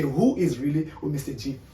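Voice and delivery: ordinary talk, nothing marked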